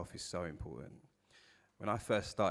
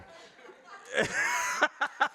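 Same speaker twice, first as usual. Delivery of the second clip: loud high laugh; 4 dB louder than usual. Real, not acted. Laugh after joke.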